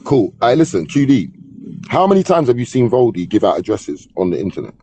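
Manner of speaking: ordinary delivery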